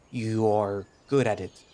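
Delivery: slow, careful attempt at "you are good at it", word by word; laboured self-correction. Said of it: "don't have to talk like that".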